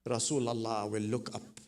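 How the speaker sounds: ordinary delivery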